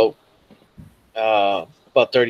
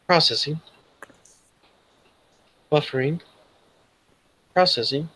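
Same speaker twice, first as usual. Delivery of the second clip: lightly voiced machine, slightly raised; computer status voice; 3 semitones higher than usual. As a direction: separate automated status prompts with long gaps. Computer status messages in earpiece.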